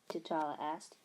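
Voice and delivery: plain reading voice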